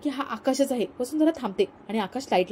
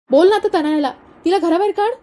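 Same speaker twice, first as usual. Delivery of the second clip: louder, higher mocking dare; sarcastic taunt; 7 semitones higher, 5 dB louder than usual. Husband mocking his wife, raised and taunting. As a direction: goad her, voice climbing.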